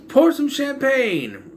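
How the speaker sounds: high, drawn-out call for champagne; acted exclamation. Reviewer quoting a cartoon joke, acted exclamation. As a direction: call it out high, stretching the words.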